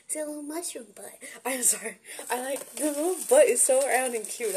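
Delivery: ordinary delivery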